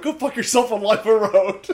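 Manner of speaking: giggling mock insult; laughing. Real, not acted. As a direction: laugh as you quote it. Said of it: "start saying that".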